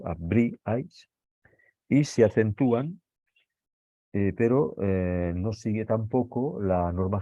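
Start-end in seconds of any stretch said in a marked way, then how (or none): 0.0-1.1 abríais stretched out, the rí stressed; slow model word. Shown as a demonstration. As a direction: stretch abríais slowly, leaning on the rí. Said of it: "se separan las sílabas". (abríais alargado, acento en la rí)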